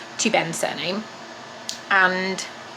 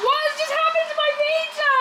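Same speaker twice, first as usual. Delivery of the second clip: much higher, disbelieving cry; horrified at the ruined pizza; shocked. Real, not acted.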